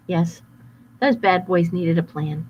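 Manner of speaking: plain reading voice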